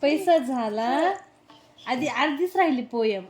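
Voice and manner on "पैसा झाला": पैसा stressed, slow, rising on झाला; emphatic recital. Shown as a demonstration. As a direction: press पैसा, slow and lifting. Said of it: "teaching the poem line".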